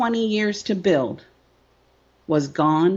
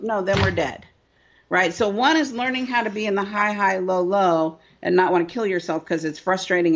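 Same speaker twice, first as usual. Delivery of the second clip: ordinary talk, nothing marked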